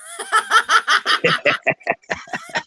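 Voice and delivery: high squealing laugh; wild high laughter; shrieking laugh. Real, not acted. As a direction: burst into a shrill cackle. Laughing at joke.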